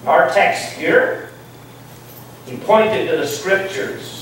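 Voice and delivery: ordinary talk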